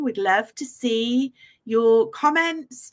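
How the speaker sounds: ordinary talk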